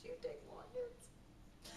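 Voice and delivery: tiny, high, slow tearful voice; holding back tears. Retold or imitated. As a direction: imitate squeezing out a question while trying not to cry. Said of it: "I'm trying not to cry".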